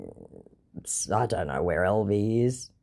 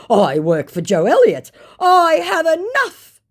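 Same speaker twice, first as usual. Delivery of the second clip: mock boastful scammer; 8 dB louder than usual. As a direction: ham up the scammer's brag. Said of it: "he claims".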